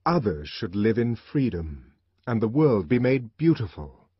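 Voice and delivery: ordinary delivery, nothing marked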